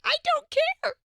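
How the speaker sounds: very high, whiny mock-tearful voice; whiny upset self. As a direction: play your younger, fed-up self near tears. Repeats upset reply to Jim.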